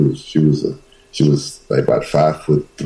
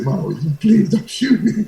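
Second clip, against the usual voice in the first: mock pleading. Boy begging armed mother.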